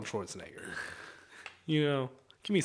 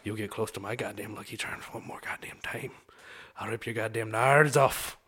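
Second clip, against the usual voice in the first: half-whispered Irish threat; quiet Irish menace; 3 semitones lower than usual. Acted. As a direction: threaten under your breath in an Irish brogue. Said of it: "And that's my Irishman".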